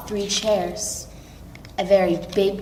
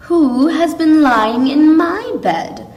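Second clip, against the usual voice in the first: Mother Bear, higher and louder; medium-sized voice; 7 semitones higher, 7 dB louder than usual. A narrator's reading. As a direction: call it up high as Mother Bear. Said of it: "she asked in a medium-sized voice".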